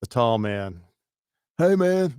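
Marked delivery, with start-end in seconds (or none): 1.6-2.1 higher, louder boss impression; imitating the boss. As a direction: voice the program director. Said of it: "that's our program director".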